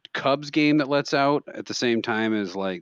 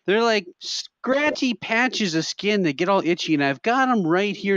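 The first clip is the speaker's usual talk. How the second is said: put-on high, drawn-out old-lady explanation; old lady customer; 8 semitones higher, slower than usual. Old lady describing shingles to clerk, high slow voice.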